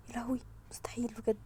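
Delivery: fast, breathy, shocked whisper. Real, not acted. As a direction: mutter it under your breath.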